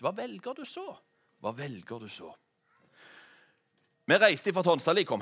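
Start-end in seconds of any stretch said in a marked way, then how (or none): 0.0-0.9 Hva velger du så? sung with the tune climbing high on så; rising sung line ending high on så; rising question. Shown as a demonstration. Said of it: "en sang". 1.4-2.3 falling answer. Shown as a demonstration. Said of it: "en sang".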